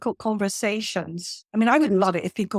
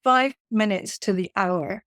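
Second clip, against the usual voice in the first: usual speaking voice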